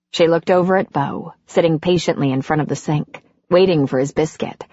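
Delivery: plain reading voice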